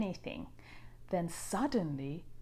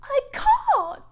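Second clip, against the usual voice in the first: much higher, louder cry; rainbow fish protesting; 10 dB louder than usual. A narrator's reading. Rainbow fish starts to protest to the octopus. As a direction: blurt out a refusal.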